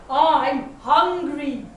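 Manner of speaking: slow, loud hungry wolf; slow wolfish relish; big bad wolf. A narrator's reading. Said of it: "thought the big bad wolf".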